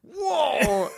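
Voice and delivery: high, loud retold whoop; mock whoop. Man recalling blowing all his money, mock whoop. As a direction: let out a big acted whoop. Acting out a spending spree.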